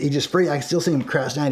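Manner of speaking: usual speaking voice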